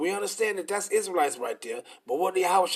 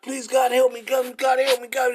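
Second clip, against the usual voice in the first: high, loud, begging cry to God; a sinner screaming for mercy, mocked loudly; mock desperate pleading; 6 semitones higher, 7 dB louder than usual. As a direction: ham up a panicked plea for help. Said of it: "Your ass gonna be screaming and hollering".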